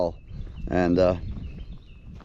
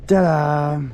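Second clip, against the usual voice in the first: showman ta-da; 5 semitones higher, 5 dB louder than usual. Pretend. Reveals surprise delivery to viewers.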